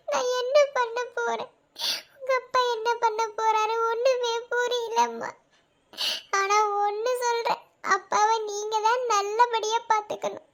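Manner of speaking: put-on a high, tearful plea to her child; a mother close to tears. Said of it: "அம்மாக்கு அழுகதான் வருது". (அழுகையுடன் பேசும் அம்மா)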